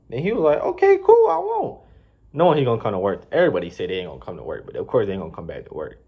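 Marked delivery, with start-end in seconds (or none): 0.6-1.8 very high, squeaky sarcastic retort; mock defiant. Jeff defying the manager, very high sarcastic. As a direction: play Jeff's fake-cheery defiance way up high.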